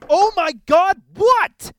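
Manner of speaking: high, loud outburst; yelled disbelief, pitch shooting up; exasperated shout. Acted. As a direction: explode at being interrupted. Interrupted while reading book.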